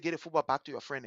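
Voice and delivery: low, soft instruction; quiet retold order. Big sister to little brother, quiet order.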